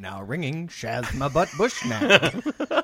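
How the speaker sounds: automated phone voice. Retold or imitated. Mimicking phone announcement.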